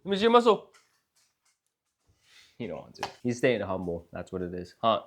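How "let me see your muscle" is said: much higher and louder, teasing insistence; louder playful coaxing. Real, not acted. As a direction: call it out high and loud to coax him. Repeats request, kid refuses.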